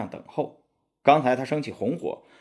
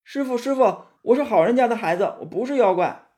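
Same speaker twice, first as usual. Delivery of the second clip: high, louder childlike cry of fake fright; faked fear; 11 semitones higher, 3 dB louder than usual. Disguised demon calling to the monk, hammed-up frightened child voice. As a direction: whimper for the master like a scared child. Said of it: "装作很害怕的样子，喊唐僧".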